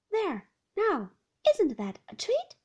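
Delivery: very high, loud coaxing nurse; cheery nurse. Acted. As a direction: coo it like to a small child. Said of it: "she said".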